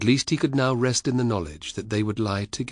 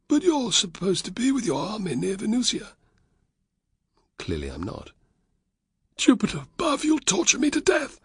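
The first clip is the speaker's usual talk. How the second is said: high, quavering voice of a terrified captive; panicking Varus; 14 semitones higher than usual. A narrator's reading. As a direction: plead in high-pitched dread.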